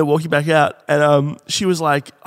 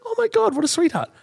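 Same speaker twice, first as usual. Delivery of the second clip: put-on much higher, gushing girly voice. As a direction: squeal it like a thrilled young woman. Mia voiced gushing thanks.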